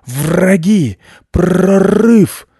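very high, loud growling shout; frantic barking guard dog; indignant dog alarm. A narrator's reading. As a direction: sound the alarm with long rolled R. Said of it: "возмутился пёс".

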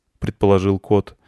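usual speaking voice

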